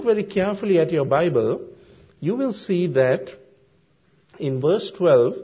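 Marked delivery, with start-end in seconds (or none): none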